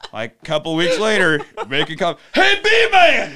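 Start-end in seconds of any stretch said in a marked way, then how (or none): 2.3-3.3 high, loud, slow hail; laughing mock yell; calling out. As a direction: draw out the greeting across the room. Calling out again.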